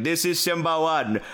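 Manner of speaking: put-on radio call in a light African accent. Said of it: "Simba One, on the radio going like".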